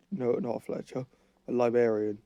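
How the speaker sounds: ordinary talk, nothing marked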